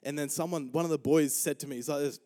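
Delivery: usual speaking voice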